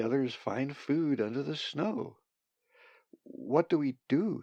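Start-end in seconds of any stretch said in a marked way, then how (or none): 3.2-4.4 worried. A narrator's reading. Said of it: "growing worried".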